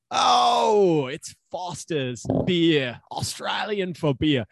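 loud high "oh", then slow Australian ad slogan; mock Australian accent. Co-host greets the can of Foster's, hammed-up Australian accent. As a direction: stretch it out like an Australian beer advert.

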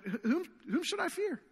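high, disbelieving whom should I fear; stammered, high-pitched questioning of whom; incredulous question. Retold or imitated. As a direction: ask it as if it makes no sense. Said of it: "David would ask that question".

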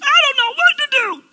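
hammed-up helpless whine; exaggerated whiny confused voice. Preacher mocking a believer who claims to be confused. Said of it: "I'm doing exaggeration".

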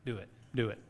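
low, hushed tempting voice; enemy's whisper. Acted. The enemy's voice urging you on, in a low hushed whisper. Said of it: "the voice of the enemy going".

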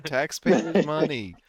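slow, drawn-out mock outrage; hammed-up huffy complaint; mock indignant. Joking about cop cruiser tow.

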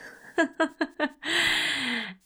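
high, bubbling laugh after the squeak; laughing at her own squeaky what; real laugh. Real, not acted. Laughs at herself while talking to viewers, amused.

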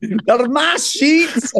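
very high, drawn-out mock mother's wail; mock Spud's mum. [name] repeating Spud's mum's line, very high and slow. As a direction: stretch it out like a wailing mum. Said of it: "based in Jamaica on that accent".

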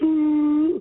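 a high, loud, drawn-out ooh of suspense; high loud nervous ooh; mock crowd gasp. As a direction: gasp like the whole arena holding its breath. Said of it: "It's like".

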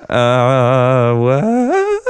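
drawn-out baffled "uh", high-pitched "what"; hammed-up confusion, voice sliding way up; clueless. Podcast host reenacting waiting through the lag, clueless. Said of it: "sitting there with clueless".

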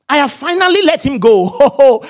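high, slow victorious announcement; hammed-up self-congratulation; mock triumph. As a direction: declare your release with exaggerated relief. Acted triumphant wife talking to herself.

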